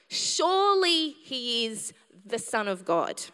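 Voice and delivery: ordinary talk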